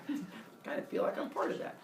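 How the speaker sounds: soft, quick near-whisper; sheepish admission. Acted. Zeus feeling responsible for Leto, sheepish. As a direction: murmur a mock guilty confession.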